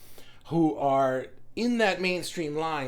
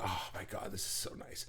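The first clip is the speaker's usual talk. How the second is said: soft, low, breathy relief; relieved sigh; 10 semitones lower, 13 dB quieter than usual. Retold or imitated. Group member relieved to find fellow believers, whispered relief. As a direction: melt with relief, almost unvoiced.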